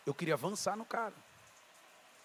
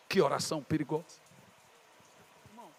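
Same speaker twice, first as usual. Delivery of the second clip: ordinary talk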